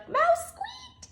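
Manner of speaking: very high squeaky reading; high mouse squeak voice. Acted.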